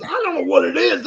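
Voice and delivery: high, puzzled imitation of Chris; imitating Chris. Host mimicking Chris's puzzled reply. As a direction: lift the voice up, baffled. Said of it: "And he goes".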